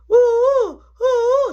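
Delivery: high, loud wordless hooting; mock deaf mama shout. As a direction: hoot him out the door. Deaf mama ordering him out.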